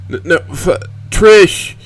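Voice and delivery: high, loud, broken cry of a name; Reed stuttering out a loud, high desperate cry. Acted. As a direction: call out after her in panic, voice cracking. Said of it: "Reed attempts to cry out".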